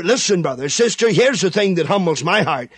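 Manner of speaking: normal talking voice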